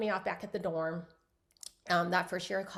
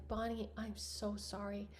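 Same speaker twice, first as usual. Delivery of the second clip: much softer, tender apology; tender sympathy; 8 dB quieter than usual. Retold or imitated. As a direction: comfort her gently. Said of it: "Emma was just like".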